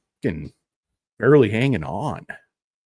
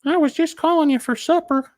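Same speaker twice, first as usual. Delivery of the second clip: very high, timid voice; meek caller; 3 dB louder than usual. Pretend. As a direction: plead innocently. The caller answers the threat.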